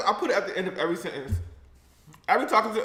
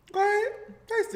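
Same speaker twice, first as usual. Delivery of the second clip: teasing high coax. Pretend. Teasing the viewers to taste.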